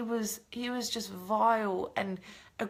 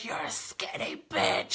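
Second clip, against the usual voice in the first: hissed low, slow and breathless, not the roar it was; a low whispered jab, slowed right down; retold insult, whispered; 7 semitones lower, 5 dB quieter, slower than usual. Retelling his insult to Tai in a low whisper. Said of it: "He was roaring and screaming at Tai".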